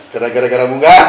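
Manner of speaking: loud, quick, through real laughter; very loud, rushed and giggly; laughing. Real, not acted. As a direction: blurt out the punchline laughing. Adds to joke while laughing.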